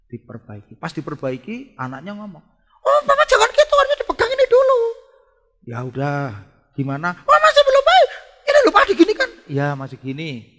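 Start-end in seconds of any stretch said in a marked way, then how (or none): 2.8-4.8 put-on loud, very high child's wail; whining child. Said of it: "Anaknya merengek". 7.3-9.3 put-on loud, very high child's wail; whining child. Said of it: "Anaknya merengek".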